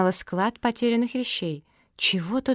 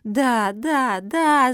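raised, louder chorus-like cries; eager yelling; 5 semitones higher, 4 dB louder, much slower than usual. Acted. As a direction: yell it out as an eager crowd. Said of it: "заорали все присутствующие".

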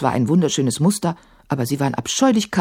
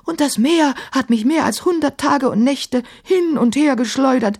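high-pitched, wounded self-defence; hurt, protesting djinn voice; aggrieved djinn; 10 semitones higher than usual. A narrator's reading. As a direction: plead innocence as an offended djinn. Djinn tells his ordeal.

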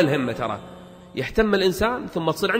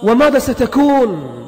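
loud, raised sermon declamation; forceful preaching; 7 semitones higher, 6 dB louder than usual. Real, not acted. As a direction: preach it out at full force, stretching key words.

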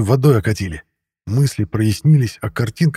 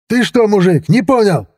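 high, raised aggressive challenge; yelling; 11 semitones higher, 4 dB louder than usual. A narrator's reading. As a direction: yell at him threateningly. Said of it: "заорал он". (агрессивный крик, высокий голос)

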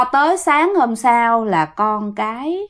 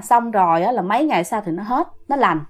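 ordinary delivery

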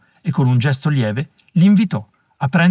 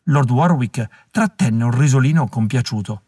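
usual speaking voice

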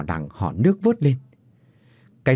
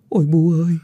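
tearful plea; 6 semitones higher than usual. Acted. Grieving woman begging her dead mother-in-law to take her along. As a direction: beg forgiveness through tears. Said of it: "nước mắt rưng rưng".

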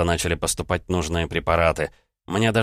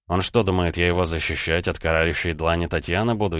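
ordinary delivery

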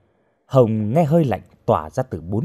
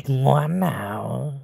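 very slow, oily coaxing; leering villain; 2 semitones higher, much slower than usual. A narrator's reading. Lecher telling struggling captive to behave, leering. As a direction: stretch each word with relish.

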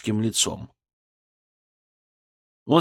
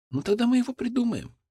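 gentle smile; 11 semitones higher, faster than usual. Acted. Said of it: "Лесков улыбнулся".

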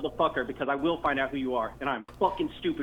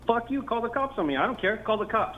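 angry, higher-pitched defiance; real anger; 6 semitones higher than usual. Real, not acted. Angry man daring a prank caller to call the cops, defiant. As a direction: dare them angrily, voice pitched up. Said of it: "Why are you getting so angry?".

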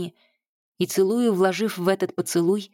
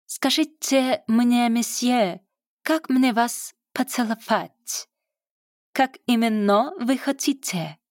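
put-on high, slow hammy French accent; terrible French accent, played for laughs; bad French accent; 5 semitones higher, slower than usual. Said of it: "чудовищно изображая французский акцент".

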